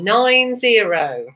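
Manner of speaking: sing-song bingo number calls, rising then falling; bingo caller. Acted. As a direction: call each number like a bingo hall host.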